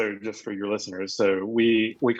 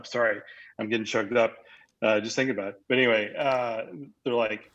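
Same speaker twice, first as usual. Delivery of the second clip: normal talking voice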